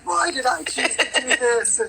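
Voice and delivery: slow, high, put-on despairing whine; mock exasperation. Singer to host, mock-lamenting her workload slowly and high. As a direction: ham up the regret, drawn out.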